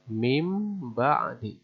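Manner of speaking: "mim ba'di" slow, nun sounded as m; drawn-out "mim ba'di" with a clear m; iqlab reading. Shown as a demonstration. Teacher repeats the correct "mim ba'di" for students. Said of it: "jelas suaranya jadi mim".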